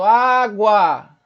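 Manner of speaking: "água" loud and drawn out; sustained A. Shown as a demonstration. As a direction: hold both A sounds of "água". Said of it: "Observa como se sustenta o A".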